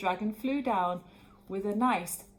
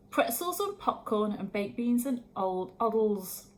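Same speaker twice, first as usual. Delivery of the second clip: ordinary delivery, nothing marked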